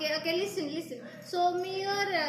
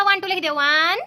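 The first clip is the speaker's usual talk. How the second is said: loud counting; 2 semitones lower, 9 dB louder, much faster than usual. Real, not acted. Child counting loudly.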